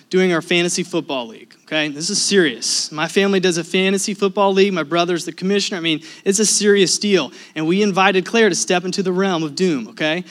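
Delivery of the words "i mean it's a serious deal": normal talking voice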